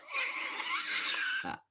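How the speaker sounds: high-pitched burst of laughter; helpless laugh at his own words; real laugh. Real, not acted. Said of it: "I don't know why I'm laughing".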